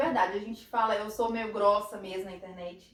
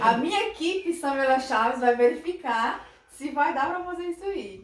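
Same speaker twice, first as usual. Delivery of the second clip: higher, louder, playfully self-important; mock important tone; 4 semitones higher, 6 dB louder than usual. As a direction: play the big boss checking her schedule. Mock important, own team. (tom de chefe importante, de brincadeira)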